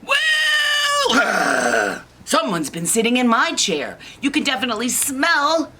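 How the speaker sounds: high, loud comic outrage; wail, then complain indignantly; high-pitched theatrical fuss; indignant Mama Bear. Acted. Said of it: "then Mama says".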